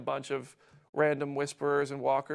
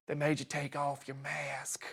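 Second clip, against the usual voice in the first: put-on a clumsy Southern accent as Alpha; bad Alpha accent; 6 dB quieter than usual. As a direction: hush it out in a poor Southern accent. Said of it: "that's a terrible accent".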